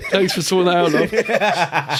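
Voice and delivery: slow thanks with laughter in it; laughing thanks. Real, not acted. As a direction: thank her while still laughing. Thanks wife for birth, laughing.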